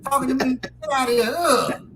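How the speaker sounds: high, loud mock disgust; disgusted woman's rebuff. Woman rejecting a stuttering stranger, hammed-up disgust. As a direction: play a woman shooing off a creep. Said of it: "came up to a woman doing that thing".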